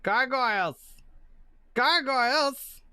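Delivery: lightly voiced gargoyle calling out slowly. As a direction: call out like a gargoyle, stretching the word. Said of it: "Gargoyle ten, he's like".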